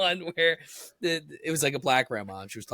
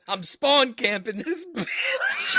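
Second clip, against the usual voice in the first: high, drawn-out punchline bursting into laughter; slow the quote down as laughter takes over; laughing hard through a quoted line; cracking up; 11 semitones higher, much slower than usual. Retold or imitated. Quoting video punchline while cracking up.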